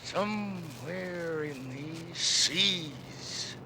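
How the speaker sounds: slow, sinking to a soft hush; brooding fade. Acted. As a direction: muse darkly to himself. Ahab's brooding whisper.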